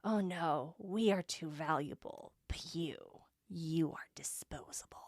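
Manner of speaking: low, soft, chilling villain voice; cold whispered menace. Acted. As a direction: sneer it softly, cold and superior. Villains' cold reply read aloud.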